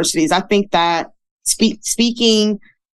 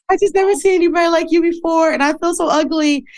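imitating crying girl; 10 semitones higher than usual.

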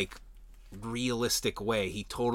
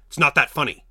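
louder, imitated scolding from an annoyed man; serious rebuke; 3 semitones higher, 8 dB louder, faster than usual. A guy telling three laughing women off, serious. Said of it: "like, he sounded serious".

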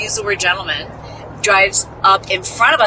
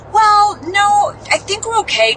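much higher, sweet and polite; polite brush-off; 12 semitones higher than usual. Retold or imitated. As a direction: decline nicely, voice lifted high. Politely turning down a stranger.

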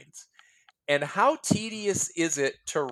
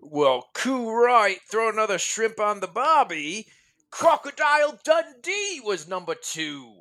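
put-on Australian accent; 7 semitones higher than usual. Host doing an Australian accent for the guest. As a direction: ham up a broad Australian accent. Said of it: "you have to do an Australian accent".

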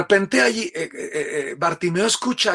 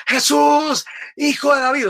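grito a todo pulmón; 10 semitones higher, 4 dB louder than usual. Acted. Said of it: "Bartimeo sigue gritando".

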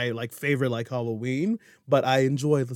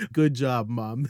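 ordinary talk, nothing marked

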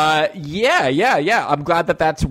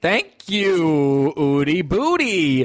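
silly nickname voice; 4 semitones higher, much slower than usual. Pretend.

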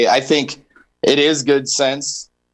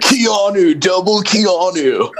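dramatic announcer; 5 semitones higher, 3 dB louder than usual. Pretend. Dramatic Keanu versus Keanu.

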